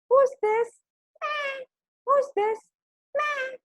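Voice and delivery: very high squeaky lamb voice with bleats; little lambs. A narrator's reading. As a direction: pipe up as wary lambs behind a door. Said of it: "said the little lambs".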